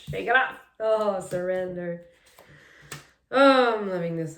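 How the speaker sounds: loud delighted exclaiming; thrilled and loud; excited. Real, not acted. Delighted reaction to the card.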